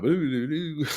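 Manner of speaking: high-pitched burbled nonsense syllables; mock Dutch gibberish. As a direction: imitate a friend babbling incomprehensibly, high and playful. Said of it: "everything he says sounds like gibberish".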